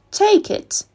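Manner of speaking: high, loud snap of a reply; quick eager reply. Acted. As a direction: blurt it out before she changes her mind. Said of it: "said Astrid quickly".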